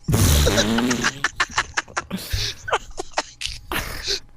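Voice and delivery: big amused laugh; laughing hard at the card; long laugh. Real, not acted.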